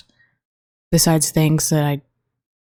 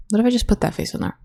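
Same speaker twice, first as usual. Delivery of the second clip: usual speaking voice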